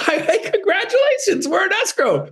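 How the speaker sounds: high, laughing, cheering escrow news; a thrilled laugh that cracks into a squeal; laughing congratulations. Acted. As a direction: burst out laughing with joy as you congratulate. Cheering clients on the accepted offer.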